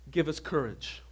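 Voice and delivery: ordinary delivery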